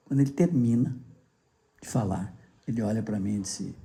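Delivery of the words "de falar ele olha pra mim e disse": ordinary talk, nothing marked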